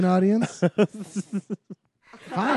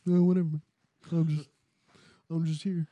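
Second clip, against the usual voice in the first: indifferent character voice; faster than usual. Pretend. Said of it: "This is my, like".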